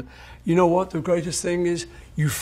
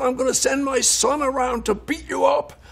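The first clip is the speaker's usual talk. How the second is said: threatening caller; 10 semitones higher than usual. Retold or imitated. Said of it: "He said".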